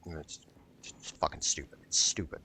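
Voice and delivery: normal talking voice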